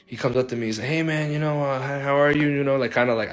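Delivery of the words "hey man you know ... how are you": a hint of a friend acting strange, higher. Friend greeting him at the locker, a little off. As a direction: lightly play the friend who seems off. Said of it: "he was kinda off a little bit".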